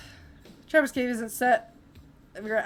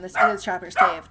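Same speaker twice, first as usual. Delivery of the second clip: normal talking voice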